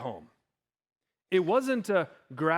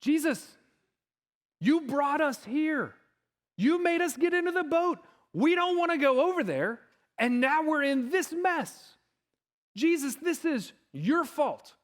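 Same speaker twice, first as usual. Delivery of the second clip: indignant disciples; 8 semitones higher than usual. Acted. Panicked disciples blaming Jesus in the storm, high indignant complaint. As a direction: blame him in a high, exasperated voice. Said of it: "what the disciples are thinking".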